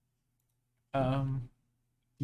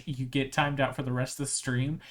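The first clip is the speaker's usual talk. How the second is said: ordinary delivery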